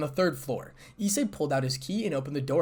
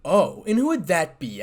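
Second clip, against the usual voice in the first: usual speaking voice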